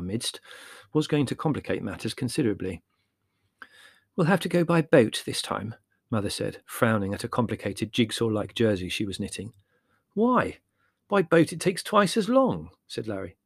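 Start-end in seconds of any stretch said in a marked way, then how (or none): 4.1-5.7 higher-pitched mother figure; lightly voiced mother. 10.1-12.7 high-pitched objection; indignant, pitched well up; protesting. Acted. Larry objects to mother's plan.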